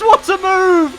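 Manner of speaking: high excited shout of the call; esports caster. Acted. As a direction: yell it like a caster.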